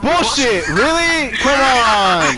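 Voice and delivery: exasperated yell at the game; frustrated shout. Real, not acted. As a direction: shout in disbelief. Teammate gets killed, frustrated outburst.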